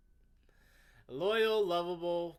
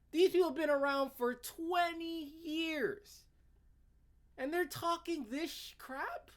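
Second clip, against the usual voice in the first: mock disbelief; 11 semitones higher than usual. Mocking disbelief at the writer.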